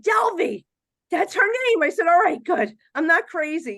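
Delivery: high, loud thrilled exclamation; shouting the name in excitement; excited. Retold or imitated. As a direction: burst out with relief and delight. Excited recognition of spirit's name.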